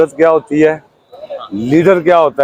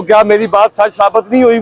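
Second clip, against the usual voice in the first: usual speaking voice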